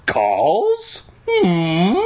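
high, questioning fake innocence; playing innocent. A narrator's reading. Kid questioned by dad about calls, playing innocent. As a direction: act like you have no idea.

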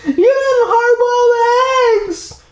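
slow, high, crying shout; Brandy accusing Mom, crying; tearful accusation. Acted. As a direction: shout the blame through tears. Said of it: "Brandy accused".

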